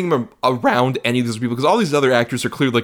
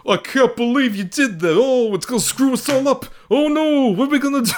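high-pitched mock dismay; hammed-up panic, pitched way up; imitating a movie actor; 12 semitones higher than usual. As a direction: wail it in a high, exaggerated impression. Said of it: "Costas just like".

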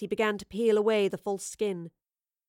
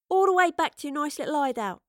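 bright higher beaming voice; proud and delighted; 7 semitones higher, 4 dB louder than usual. A narrator's reading. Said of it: "she beamed".

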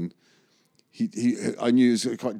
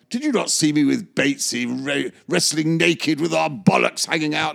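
higher, louder mock indignation; indignant Oliver; 7 semitones higher, 5 dB louder than usual. Oliver demanding Simon know his films, indignant. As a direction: puff up as the offended star. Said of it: "And he go".

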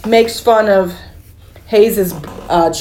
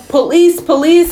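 retold yell; 6 semitones higher than usual. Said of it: "And they yell".

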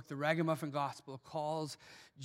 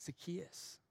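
soft, tender calling of a name; hushed loving call; said with love; 2 semitones higher, 9 dB quieter than usual. Acted. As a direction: call him gently with love. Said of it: "he said it with love".

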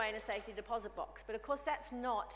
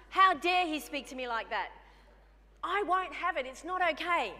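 mock indignant outburst; 5 semitones higher, 8 dB louder than usual. Her retold indignant anger.

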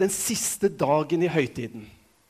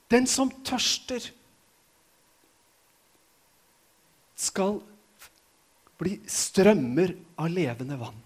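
solemn; 3 dB quieter, slower than usual. Shown as a demonstration. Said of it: "repeating the promise slowly".

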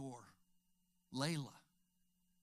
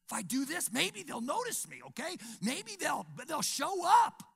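high, rising plea to be noticed; attention-seeking child; 10 semitones higher, 6 dB louder than usual. Retold or imitated. Child trying to get parents' attention, high and pleading. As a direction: beg to be seen like a needy kid.

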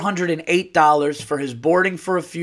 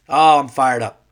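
fired up; 7 dB louder than usual. Real, not acted. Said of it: "this tone".

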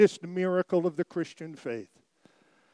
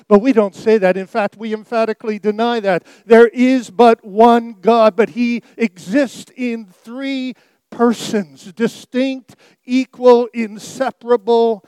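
higher, louder, rising sermon delivery; full-force preaching; 5 semitones higher, 9 dB louder than usual. Acted. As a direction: preach it out to the congregation, building. Emphatic doctrinal declaration.

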